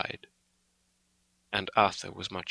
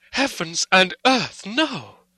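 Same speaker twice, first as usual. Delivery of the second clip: high, slow, loud cry of outrage; storm of passion; 11 semitones higher, 7 dB louder, slower than usual. A narrator's reading. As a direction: cry out in horrified refusal. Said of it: "cried Arthur in a storm of passion".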